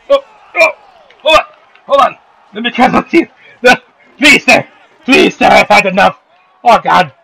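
high, loud hammed-up cries for mercy; mock desperate begging. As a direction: squeal please sir like a beaten underdog. Voiced fighter begging the opponent.

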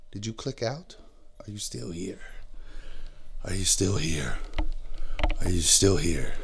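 very low, hushed mock-creepy voice; eerie check-in. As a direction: sink deep and soft, probing whether anyone is there. Eerily checking whether viewers still watch.

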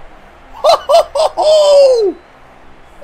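delighted howl of laughter; a long high cackle of joy; loud high laugh. Real, not acted. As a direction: burst out laughing loudly. After the catch.

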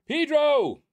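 high, loud drawn-out holler; called-out name. Real, not acted. Vlogger greeting viewer Pedro, excited holler. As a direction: belt the name out high and long.

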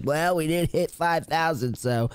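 high, slow put-on voice; mimicking someone weaseling out of a promise; mock excuse-making. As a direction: drag it out like a feeble excuse. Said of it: "I'm not gonna hold it out on you guys".

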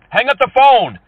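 high, loud order down the phone; angry shout. Real, not acted. Caller ordering a scammer off the line, angry shout.

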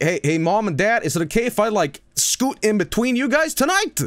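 put-on high, climbing little-kid plea; pleading kid. Scared kid asking to sleep between parents, pleading.

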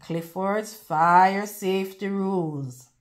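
ordinary delivery

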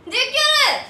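high, loud shout; angry demand. Acted. Woman to a staring stranger, angry shout. Confronts a man staring at her.

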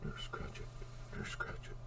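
soft whispered name; whispering. Real, not acted. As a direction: whisper it to yourself.

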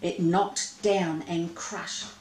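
ordinary delivery, nothing marked

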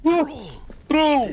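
high panicked yell; being chased. Real, not acted. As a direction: shriek the name in alarm. Troll appears, yells.

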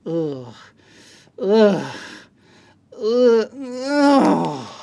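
repeated groans of disgust, each on its own; disgusted ughs. Real, not acted. Reviewer recoiling from a crap soldering iron, disgusted groans. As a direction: groan "ugh" in disgust, pausing between.

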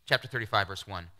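normal talking voice

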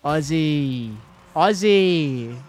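loud, rising chant call. Pretend. Streamer calls an Aussie chant to chat, loud. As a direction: belt it like a crowd chant.

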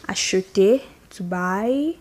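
normal talking voice

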